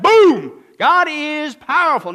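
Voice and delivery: sudden loud boom, then a slow, high, loud line; BOOM, then slow and big. Real, not acted. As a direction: wake the room with a bang. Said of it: "Now you're awake".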